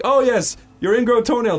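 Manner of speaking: high, lifted doctor patter; imitated breezy doctor. Doctor to ingrown toenail patient, imitated breezy assurance. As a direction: act out a doctor's airy assurance.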